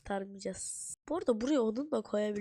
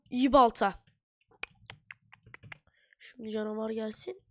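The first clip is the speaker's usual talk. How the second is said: ordinary talk, nothing marked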